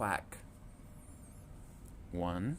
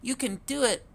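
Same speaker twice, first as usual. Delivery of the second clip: high, louder reassurance; encouraging mother duck; 12 semitones higher, 3 dB louder, faster than usual. A narrator's reading. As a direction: cheer the little ones on. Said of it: "Mama said".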